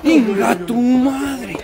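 slow, loud shocked outburst; startled curse. Real, not acted. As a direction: blurt it out in fright. Startled by a bang in the dark.

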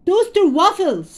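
high loud call. A narrator's reading. Dad yelling his order back to mom. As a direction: holler the answer across the room. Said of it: "Dad yelled louder".

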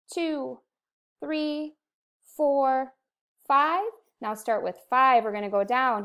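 ordinary talk, nothing marked

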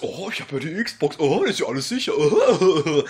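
low, sarcastic mimicry; mocking the console's claims. Mocking Xbox security. (spöttisch nachäffend)

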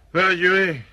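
loud, high command to move on; officer barking the squad forward; called order. Acted. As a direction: push the men forward with a shout.

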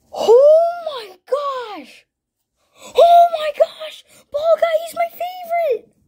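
high-pitched, loud squeal of joy; ecstatic scream. Real, not acted. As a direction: scream out in real disbelief at a dream pull. Pulls his favourite card.

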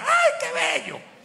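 very high, slow swoon. Retold or imitated. Latin Americans swooning over a strongman, high mock gush. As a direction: gush like an adoring fan.

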